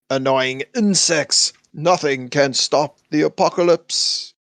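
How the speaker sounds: slow, booming villain voice; Apocalypse voice. Retold or imitated. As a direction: proclaim it like an ancient god. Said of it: "and he's like".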